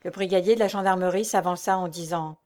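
plain reading voice

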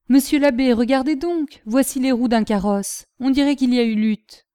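loud, high gardener's exclamation; exclaiming. A narrator's reading. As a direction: call out to the abbot. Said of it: "et il s'exclama".

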